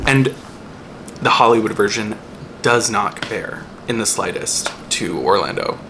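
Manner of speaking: usual speaking voice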